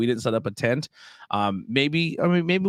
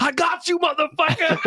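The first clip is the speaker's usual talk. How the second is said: very high gotcha yell, breaking into laughter; laughing, hammed-up gotcha; mock triumphant. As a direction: imitate a gloating caller, squealing high. Caller springs the trap, triumphant.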